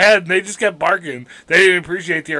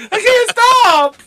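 mock distress; 4 dB louder, slower than usual. Acting helpless dancing victim.

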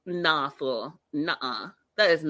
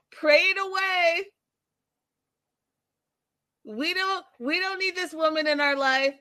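higher hammed-up outraged family voice; mock mother and sister; 7 semitones higher than usual. Vlogger voicing mom and sister rejecting the woman, mock outrage. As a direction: play the scandalized mom, pleading to the Lord. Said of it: "They're like".

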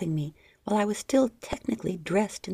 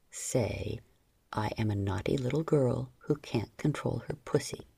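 low, soft male command; 7 semitones lower, 5 dB quieter than usual. A narrator's reading. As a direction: order it quietly and low.

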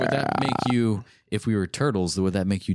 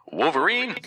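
higher-pitched ring announcer call; flavored announcer read; 6 semitones higher than usual. Shown as a demonstration. Host livening up the Avengers list, announcer voice. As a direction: announce it with a big pitch lift. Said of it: "add a little bit of flavor".

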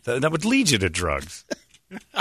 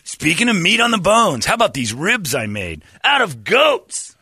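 high, brash, slower chef pitch; loud TV chef; 10 semitones higher, 4 dB louder than usual. Pretend. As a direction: sell it like a TV chef. Mocking Guy Fieri pitch.

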